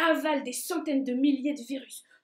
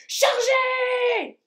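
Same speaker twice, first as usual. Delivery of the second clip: loud, high battle cry; 11 semitones higher, 6 dB louder than usual. Acted. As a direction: yell the charge. General orders the charge.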